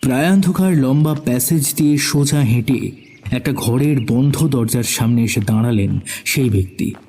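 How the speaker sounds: ordinary delivery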